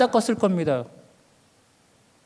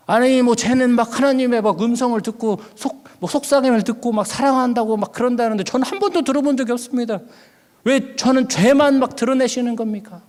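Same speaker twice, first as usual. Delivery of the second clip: high-pitched indignant complaint; aggrieved protest; 5 semitones higher than usual. Retold or imitated. Preacher complaining to God about the favoured junior, aggrieved protest. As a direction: demand an answer from God, pitch raised. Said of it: "하나님, 억울합니다".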